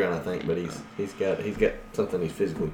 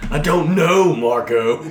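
loud, high "I don't know, Margo"; loud mock quote; 8 semitones higher, 9 dB louder, slower than usual. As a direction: belt out the line. Quoting movie line.

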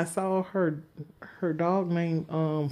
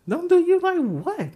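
much higher, louder, outraged; indignant repeat; 12 semitones higher, 7 dB louder than usual. Retold or imitated. Retelling the landlady encounter, outraged high repeat. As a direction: throw it back at her, shocked and loud.

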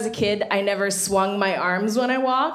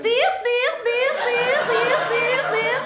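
high, buzzing mock laugh like a kazoo; mom's kazoo laugh; much slower than usual. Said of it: "this really ridiculous laugh that sounds like she's trying to say the word dick ... through a kazoo".

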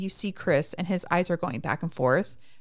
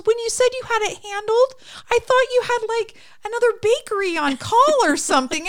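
high mock voice; 7 dB louder than usual. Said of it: "And he goes".

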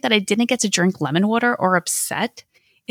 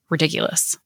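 usual speaking voice

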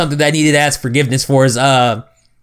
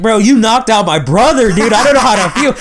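put-on higher, stunned kid voice; shocked kid voice; 6 semitones higher than usual. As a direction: play the stunned brother. Retelling shocked sibling.

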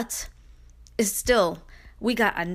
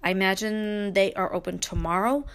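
usual speaking voice

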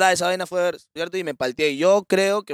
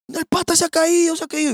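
panicked retelling; 10 semitones higher than usual. Retold or imitated. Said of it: "he tells the neighbours".

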